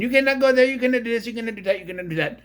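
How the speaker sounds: an officer barking orders that die down; stern warning shout. Retold or imitated. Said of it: "She's there saying".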